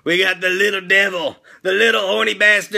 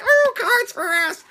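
put-on much higher, slow childish squeal of delight; kids' voice; 14 semitones higher, slower than usual. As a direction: play greedy little kids spotting treats. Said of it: "the Grimettes are like".

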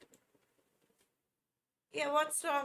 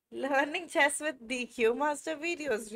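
ordinary talk

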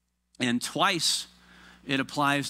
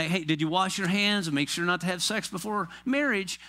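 higher, hurried mock-parent check-in; pit stop parent; 6 semitones higher than usual. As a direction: rattle through the questions without listening. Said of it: "doing pit stop parenting".

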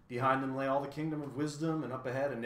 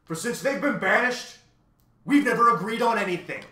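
high, loud and indignant; still thundering; 11 semitones higher, 13 dB louder than usual. A narrator's reading. Math magician tells Tok of the quarrel, loud. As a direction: keep the roar going.

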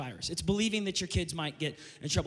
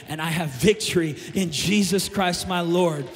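shouted, high triumphant proclamation, slowing down; preaching at full force; 4 semitones higher, 8 dB louder than usual. Acted. Pastor shouting victory over death to the church, full-force preaching.

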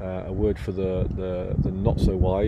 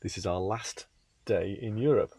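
hushed; 6 dB quieter than usual. Real, not acted. Speaks hushed in the early morning.

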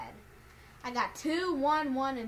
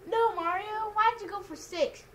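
exasperated outcry; 9 semitones higher, 6 dB louder than usual. Real, not acted. Dismay at partner's bad move.

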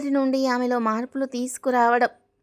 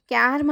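ordinary delivery, nothing marked

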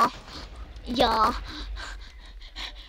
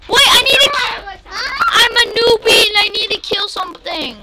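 ordinary delivery

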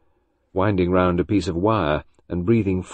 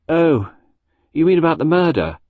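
put-on bored boy, voice still raised; a touch of boredom, higher and louder; 10 semitones higher, 5 dB louder than usual. A narrator's reading. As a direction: answer like an uninterested boy. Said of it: "He sounded bored".

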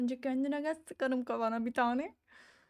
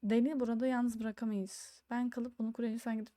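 ordinary delivery

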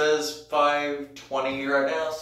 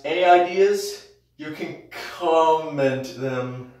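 ordinary talk

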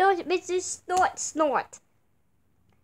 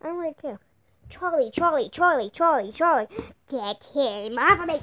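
normal talking voice

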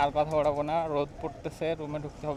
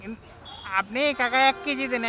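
calling out; 10 semitones higher than usual. Real, not acted. Ordering loudly from vendor.

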